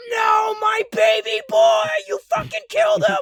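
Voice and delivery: hammed-up, very high screaming wail; mom wailing. Mom thinking her boy was killed, imitated wailing. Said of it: "I heard my mom fucking wailing".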